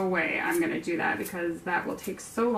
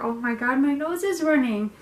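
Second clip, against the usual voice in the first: usual speaking voice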